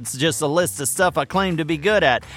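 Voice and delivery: ordinary delivery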